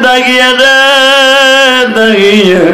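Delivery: mournful melodic lament; tearful calling. Acted. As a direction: call out in grief, stretching the last syllable. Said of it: "নবী আমার চোখটা বন্ধ করে কাঁদে আর বলে".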